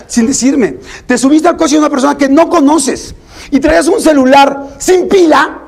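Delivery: a parent whose disbelief swells into alarm; hammed-up parental scolding, rising with a laugh; building scolding. Parent scolding teen over party.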